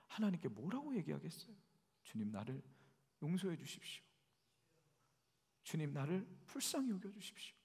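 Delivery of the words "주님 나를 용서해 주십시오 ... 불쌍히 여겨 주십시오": softer pleading prayer to the Lord; humble prayer. Pretend. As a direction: pray it quietly, asking for mercy. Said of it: "그 기도하지 않겠습니까".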